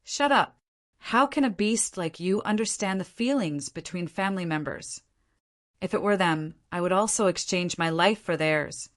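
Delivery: ordinary delivery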